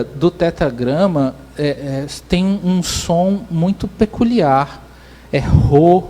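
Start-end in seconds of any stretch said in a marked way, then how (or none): 5.4-6.0 "Rô" released like a breath; breath-like sound. Shown as a demonstration. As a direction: let "Rô" out as a puff of breath, not a crisp syllable. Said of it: "uma respiração e não".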